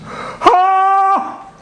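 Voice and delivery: high mock scream of pain; high pained yell. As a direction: let out a high cry of pain. Chris screaming in hospital.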